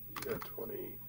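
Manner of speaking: whispered repeat of the roll, no voice. Real, not acted. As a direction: breathe it to yourself as an aside. GM repeats result under breath.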